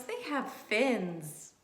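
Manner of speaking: plain reading voice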